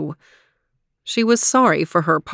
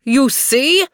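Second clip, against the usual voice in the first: higher, triumphant exclamation; exclaiming; 6 semitones higher, 4 dB louder than usual. A narrator's reading. Master embraces the boy in joy, triumphant exclamation. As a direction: pitch it up, pleased to be proved right. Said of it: "he exclaimed".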